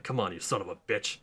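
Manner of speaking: low, soft, fast growl-like taunt; a low, quiet tough-guy dare; gritty Batman. Retold or imitated. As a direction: dare them under your breath. Battered Batman taunting goons.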